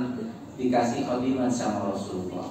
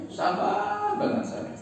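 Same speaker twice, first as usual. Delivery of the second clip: mock patient husband; slower than usual.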